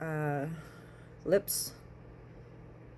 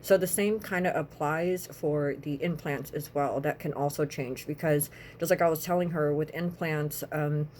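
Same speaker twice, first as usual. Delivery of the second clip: ordinary talk, nothing marked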